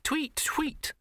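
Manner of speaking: high chirping tweet tweet; bird tweets. A narrator's reading. Bluebirds circling the dazed bursar, high chirping. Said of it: "bluebirds went tweet".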